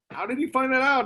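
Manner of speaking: high, amazed disbelief; amazed question. Retold or imitated. Brokaw marveling at the researcher's finds, amazed. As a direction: marvel at how he learned it.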